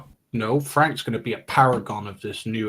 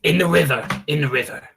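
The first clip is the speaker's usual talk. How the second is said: raised, urgent correction; insisting on the river; 5 semitones higher, 5 dB louder than usual. Acted. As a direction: push back loudly on the plan.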